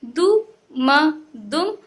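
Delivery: "दु", "म" slowly apart, then "दुम" whole; slow separate sounds resolving into "दुम"; broken, then joined. Shown as a demonstration. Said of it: "तोड़ तोड़ के पढ़ना".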